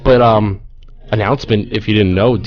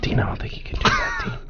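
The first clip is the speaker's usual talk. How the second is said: hushed, softer aside to a colleague; whispering; 8 dB quieter than usual. Real, not acted. Co-host to Dean about what he can do, whispering. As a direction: whisper it privately under your breath. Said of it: "legit whispering".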